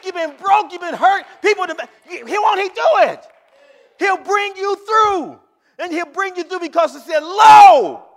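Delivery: loud, high, shouted gospel preaching; preaching at full force. Real, not acted. Preacher shouting to the congregation that God will bring them through. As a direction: rouse the church to its feet.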